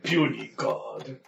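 slow, soft sneering growl; scornful monster; Hulk voice. Acted. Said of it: "I'm gonna be Hulk".

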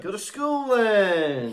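high, slow caricature of Manchester speech; mocking Manchester impression. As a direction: drag out the mock Manchester accent. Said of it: "People do impressions of me".

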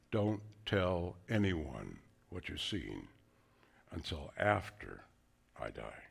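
a quiet warning to disciples; hushed command. Retold or imitated. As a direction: lean in and say it quietly. Jesus's command quoted softly.

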